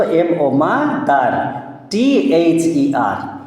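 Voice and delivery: letters spelled out slowly, one by one; slow letter-by-letter spelling. Shown as a demonstration. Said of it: "spelling out MOTHER for child".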